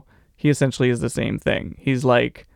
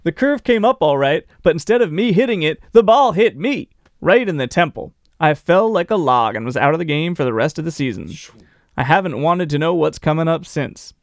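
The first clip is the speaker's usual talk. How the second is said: hammed-up Southern storytelling; Southern accent; 2 semitones higher than usual. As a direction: read the quote as a Southern ballplayer telling his tale. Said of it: "he's acquiring a Southern accent".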